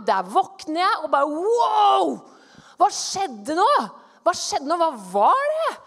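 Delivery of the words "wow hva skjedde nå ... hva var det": loud, high-pitched astonishment on waking; startled amazement. Retold or imitated. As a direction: burst out, stunned and loud. (høylytt, forbløffet utbrudd)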